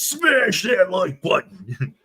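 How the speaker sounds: drawn-out, hammed-up youth slang; mock youngster catchphrase. Host imitating what youngins say to viewers, hammed-up and slow. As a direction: stretch it out slowly with a laugh. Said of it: "youngins like to say these days".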